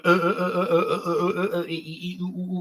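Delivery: exaggerated stammering uh-uh-uh, voice climbing high then fading; mock a movie character stuttering badly. Streamer mocking a stuttering movie character, exaggerated stammer.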